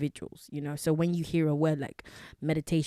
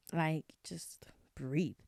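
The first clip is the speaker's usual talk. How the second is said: soft, slow whispered breathe; hushed slow whisper; 6 dB quieter, much slower than usual. Shown as a demonstration. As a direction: breathe the words out slowly.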